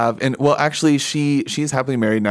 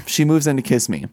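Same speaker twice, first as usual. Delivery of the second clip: ordinary delivery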